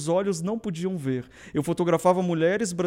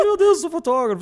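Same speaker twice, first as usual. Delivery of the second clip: excited exclamation; 10 semitones higher, 7 dB louder than usual. Retold or imitated. Retold moment of realizing.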